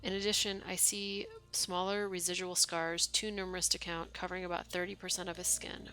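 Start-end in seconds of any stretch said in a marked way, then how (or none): none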